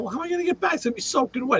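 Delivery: baffled objection to a crazy order; incredulous. Retold or imitated. Objecting to the boss about a soaked car seat, high incredulous protest.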